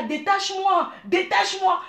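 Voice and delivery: hammed-up husband begging to be untied; playful wife voices her tied-up husband's pleas; pleading husband. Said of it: "Il me dit".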